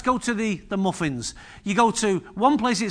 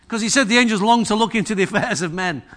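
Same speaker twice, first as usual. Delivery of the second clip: usual speaking voice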